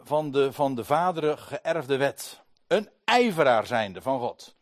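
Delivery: luid en hoger, gedragen; gedragen toespraak. Acted. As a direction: verdedig je vol overtuiging. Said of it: "Hij zegt". (Paulus verdedigt zich luid voor de menigte)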